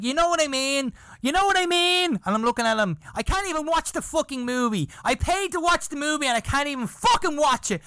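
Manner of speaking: put-on high, heated kid's outburst; retold angry rant. Boy ranting at the man who spoiled his movie, high and furious.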